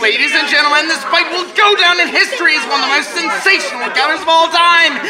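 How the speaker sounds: fast, rising fight call; excited ringside commentary. Acted. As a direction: call the knockout like a radio announcer.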